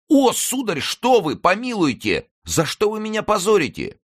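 very high, loud wail of protest; outraged lackey. A narrator's reading. Lackey protesting being called a coward, shrieky outrage. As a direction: send the voice way up, scandalised and pleading.

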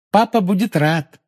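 high, merry promise; lighthearted girlish glee; cheerful. A narrator's reading. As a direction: promise it brightly, pleased. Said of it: "весело пообещала Полумна".

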